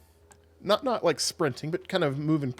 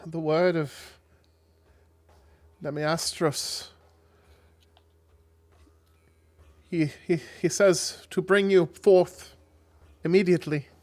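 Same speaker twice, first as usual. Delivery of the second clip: raised, higher herald voice; reverent messenger; 5 semitones higher, 6 dB louder than usual. Acted. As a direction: deliver a solemn summons, haltingly. Messenger delivers the god's summons.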